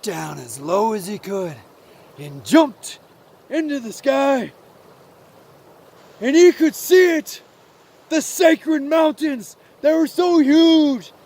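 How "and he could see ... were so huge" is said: high, loud, thrilled storytelling; awestruck narration. A narrator's reading. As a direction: tell it swept up in the wonder. Narrator at the awe-filled climax.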